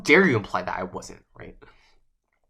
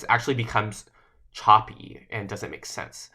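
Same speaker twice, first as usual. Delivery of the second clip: normal talking voice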